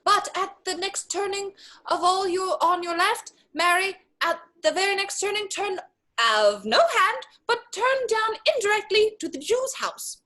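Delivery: high-pitched disguised voice; comic high voice to fool someone; teasing put-on voice. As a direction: give confusing directions in a high mock-helpful voice. Lancelot tricks his blind father.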